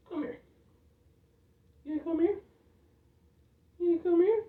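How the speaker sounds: high sing-song coo to a hamster. Real, not acted. Owner cooing to his hamster in a high voice.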